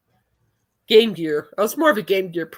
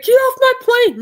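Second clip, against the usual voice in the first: put-on much higher, loud shrill screech of a twelve-year-old; screaming kid; 13 semitones higher, 5 dB louder than usual. Imitating the twelve-year-old screaming in the Facebook reel, high and loud. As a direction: shriek it like an angry kid.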